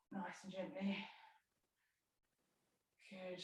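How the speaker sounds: breathy whispered cue, barely voiced; hushed yoga whisper. Real, not acted. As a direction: drop to a whisper to coax them gently. Cueing a gentle stretch.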